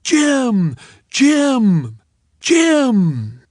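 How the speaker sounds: high, loud chanted name; crowd bellow. A narrator's reading. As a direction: roar it like a whole stadium. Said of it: "the hometown crowd would bellow".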